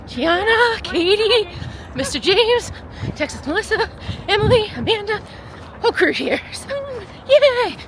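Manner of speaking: high, loud group roll call; excited cheer. Real, not acted. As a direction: hype up the running crew.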